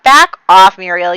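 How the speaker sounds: telling a meddler to back off, loud; sharp shout. Retold or imitated. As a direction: bark it at the intruder. Margaret tells Muriel to leave.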